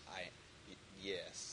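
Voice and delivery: nervous laughing stammer; stuttering. Real, not acted. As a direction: stumble into the yes. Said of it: "Was that a stuttering of excitement?".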